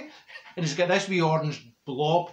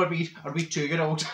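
ordinary delivery

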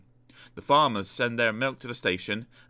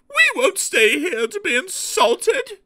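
very high, loud, offended carriages; fuming; 8 dB louder than usual. A narrator's reading. Carriages most offended at being called rubbish, fuming. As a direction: fume with wounded pride in a high, slow voice. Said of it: "they fumed".

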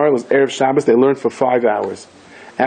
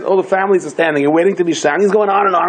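usual speaking voice

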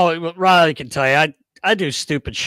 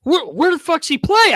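high-pitched, imitated frantic demand; mock-flustered, voice jumping way up; agitated disbelief; 12 semitones higher, 4 dB louder than usual. Equipment manager questions unknown new player, agitated. Acting victim's agitated reply.

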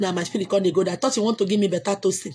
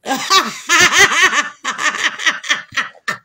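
loud, high cackling laugh; long run of laughter, gradually dying away; real belly laugh; 6 semitones higher, 5 dB louder than usual. Real, not acted. Bursts out laughing at comments.

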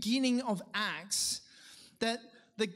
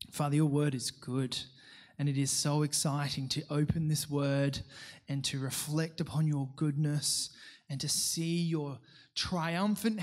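lower, hushed pastoral prayer; 7 semitones lower than usual. Acted. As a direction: pray earnestly on behalf of the church. Pastor prays aloud.

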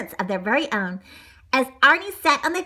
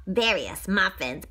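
ordinary delivery

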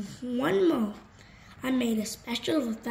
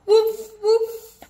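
high, loud yappy puppy barks; 10 semitones higher, 9 dB louder than usual. Acted. Reader barking as Biscuit the puppy, high and loud. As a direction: yip like a little puppy, much higher.

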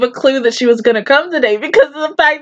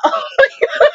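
laughing; 11 semitones higher than usual. Real, not acted. Laughing reaction.

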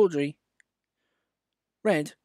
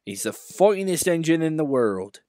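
proud; 6 semitones higher, 4 dB louder than usual. Acted. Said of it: "said Duck".

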